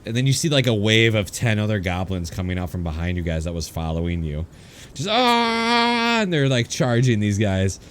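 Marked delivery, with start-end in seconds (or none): 5.1-6.2 high shouted charge cry; short raised battle cry; goblin war cry. Acted. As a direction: yell it like a charging horde.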